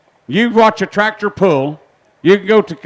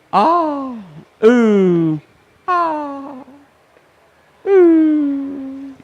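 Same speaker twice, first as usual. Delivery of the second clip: excited oohs; 8 semitones higher, much slower than usual. Real, not acted. Fireworks over the house.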